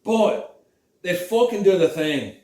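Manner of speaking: put-on joking Scottish accent carried through the story. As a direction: keep the mock-Scottish accent going. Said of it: "I would fucking do this for the entire fucking video".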